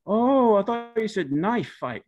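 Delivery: oh, so that's what you meant; surprised realization. Retold or imitated. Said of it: "dad realizing the misunderstanding".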